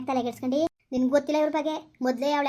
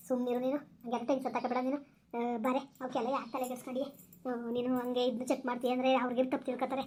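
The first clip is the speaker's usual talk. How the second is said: softer, calming friend's voice; softer reassurance to an upset friend; soothing; 3 semitones lower, 7 dB quieter than usual. Acted. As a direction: hush her gently and reassure.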